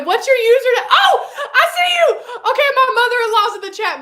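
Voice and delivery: high, loud, laughing excitement; delighted excitement. Real, not acted. Streamer finds her mother-in-law in the chat, excited shout. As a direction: call out excitedly through laughter.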